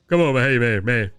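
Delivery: put-on deep voice; Cat. Host mimics Cat's switch into his deep voice. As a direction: drop it low for the deep-voiced version. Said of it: "talk with my deep voice now".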